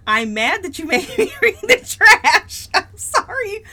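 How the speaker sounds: laughing through mock outrage; laughing rant. Real, not acted. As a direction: rant while cracking up. Laughing rant about book.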